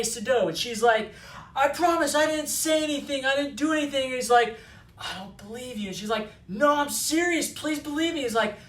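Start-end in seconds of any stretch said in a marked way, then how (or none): 1.6-4.1 higher-pitched protest of innocence; pitched-up, hammed-up insistence; mock pleading. Accused woman protests in retelling. 5.0-5.9 softly voiced doubting hero; much softer, understated dismissal; mock disbelief. Hero coldly doubts her, retold. 6.5-8.2 higher-pitched protest of innocence; pitched-up, hammed-up insistence; mock pleading. Woman pleads in retold scene.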